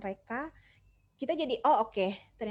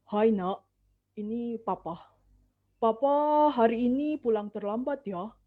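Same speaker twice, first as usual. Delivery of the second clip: a lightly voiced dad, a bit louder; father voice; 7 dB louder than usual. Shown as a demonstration. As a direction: speak as a father calling to his child, slightly louder. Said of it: "Itu pitch saya untuk ayah". (suara ayah yang sedikit lebih keras)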